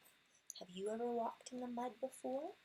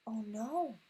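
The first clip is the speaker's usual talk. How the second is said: usual speaking voice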